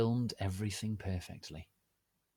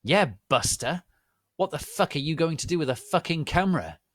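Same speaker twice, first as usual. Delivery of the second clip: loud, fast, higher confrontation; fast angry demand; protective demand; 8 semitones higher, 8 dB louder, faster than usual. Acted. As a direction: challenge him to defend her. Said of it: "Draco demanded, all protective".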